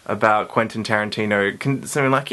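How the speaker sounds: ordinary talk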